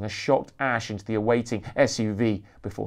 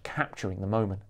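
plain reading voice

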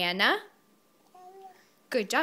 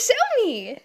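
high, eager coaxing; 11 semitones higher than usual. Real, not acted. Parent coaxing baby.

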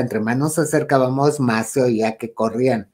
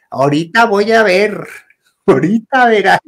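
loud, high scolding; cranky aunt's mock threat; 8 semitones higher, 7 dB louder than usual. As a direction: warn the kids off sharply. Cranky aunt threatening the kids.

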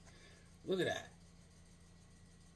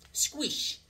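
whispered squish; 9 semitones higher, 10 dB quieter than usual. Acted. Comic sound effect.

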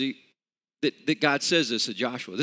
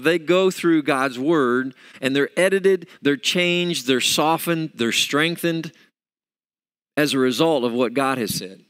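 rising preacher's push, louder; fervent preaching; 2 semitones higher, 6 dB louder than usual. Acted. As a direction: drive the point home to the congregation.

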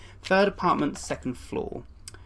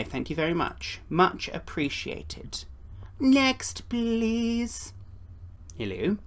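usual speaking voice

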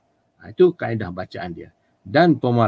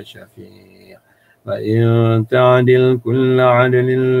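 Quran recitation. Shown as a demonstration. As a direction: recite in the Arabic lilt with clear makhraj. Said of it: "begitu bacaan dia".

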